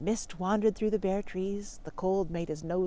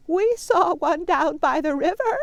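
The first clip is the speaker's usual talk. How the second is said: goat wife voice; 8 semitones higher, 6 dB louder than usual. Acted. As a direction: call it out like a helpful she-goat. Missus Goat tells.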